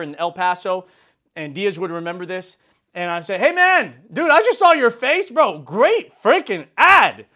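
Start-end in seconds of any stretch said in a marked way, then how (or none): 3.4-7.3 shouted, much higher bro greeting; big hype shout, slow stretched out on great freaking ad; loud hyped greeting. Retold or imitated. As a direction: hail him loudly like a long-lost buddy.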